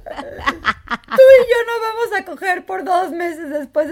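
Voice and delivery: laughing hard through a retold line. Friend retelling her boyfriend's jab, laughing hard. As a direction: crack up while quoting him.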